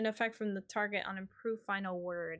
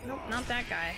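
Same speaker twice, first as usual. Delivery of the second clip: ordinary talk, nothing marked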